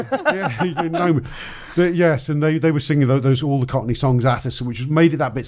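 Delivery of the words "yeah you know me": laughing. Real, not acted. Laughing reply to teasing.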